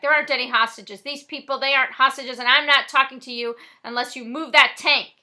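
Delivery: loud, firm shout; yelling back. Acted. Frosty yells back at the cop, loud. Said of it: "Frosty yells back".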